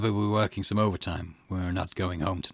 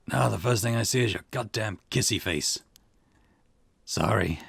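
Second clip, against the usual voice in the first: ordinary delivery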